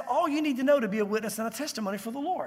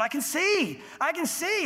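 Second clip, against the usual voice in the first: higher-pitched joyful discovery; amazed exclamation; 7 semitones higher, slower than usual. Acted. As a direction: exclaim it like a man just given sight. Imagined healed blind man exclaims.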